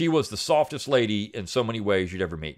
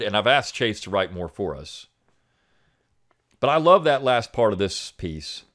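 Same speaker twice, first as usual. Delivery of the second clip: normal talking voice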